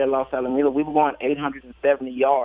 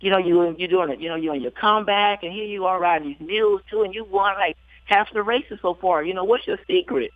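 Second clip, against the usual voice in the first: higher-pitched imitation of an excited fan; excited reporter; 5 semitones higher than usual. Reporter congratulating a jockey on his comeback, mock-excited. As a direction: retell his gushing, mildly worked up. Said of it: "he's all excited".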